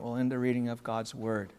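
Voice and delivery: normal talking voice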